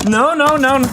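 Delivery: high, loud mock panic, no no no; playful alarmed no. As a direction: cry out in playful alarm at a misbehaving toy. Part toy acts up playfully.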